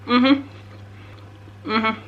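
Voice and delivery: talking while chewing; words round a bite of food; mouth full. Real, not acted. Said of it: "I'm just having a little snack".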